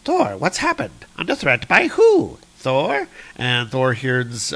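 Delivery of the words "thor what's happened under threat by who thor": high, worried queen; Freya. Acted. Queen questioning Thor about the threat, high and anxious. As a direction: pitch up in mock alarm.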